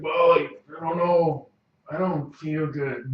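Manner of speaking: slow, queasy groan; sickly man. Acted. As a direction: say it feeling sick. Sick NPC before vomiting.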